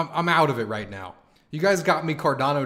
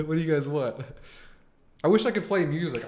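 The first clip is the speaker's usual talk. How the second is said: normal talking voice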